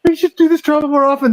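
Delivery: high-pitched, gushing giddy imitation; mock giddy. Mocking streamers thrilled by twenty live viewers, high and giddy. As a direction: squeal it in mock excitement, well above your voice. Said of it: "get all giddy about it".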